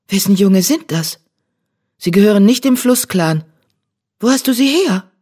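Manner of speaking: höher und lauter, beunruhigt fragend; erschrocken. A narrator's reading. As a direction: besorgte Katzenmutter, aufgeschreckt. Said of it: "schauten erschrocken". (erschrockenes Nachfragen)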